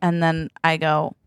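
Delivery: ordinary talk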